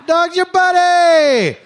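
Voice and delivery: slow, loud, high mocking exclamation; sarcastic buddy. Comic mocking the owner's bond with the dog, slow loud sarcasm. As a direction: stretch out the mockery.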